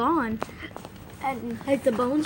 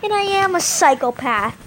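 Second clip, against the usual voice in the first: gleeful boast; 7 semitones higher, 7 dB louder than usual. Acted. Proud gleeful claim.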